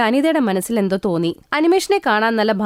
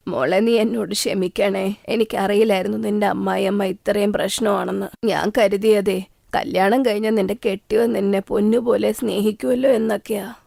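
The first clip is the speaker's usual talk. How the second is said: regretful mother. Acted. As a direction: apologize gently to your daughter. Anita's mother apologizes.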